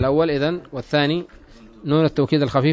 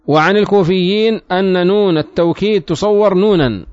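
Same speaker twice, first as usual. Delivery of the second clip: slow, raised classical Arabic reading; formal text reading; 4 semitones higher, 4 dB louder, slower than usual. Acted. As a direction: read each clause out deliberately. Reads the book text aloud.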